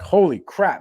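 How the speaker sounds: sudden high loud holy crap; surprised exclaim. Retold or imitated. Salesman to Nancy, loud surprised burst. Reacting to smell in house.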